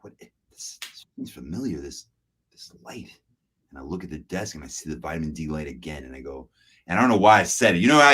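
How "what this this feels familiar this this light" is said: hushed whisper of dawning dread; whispered realization. Retold or imitated. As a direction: breathe it out as you realize. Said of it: "and I'm like".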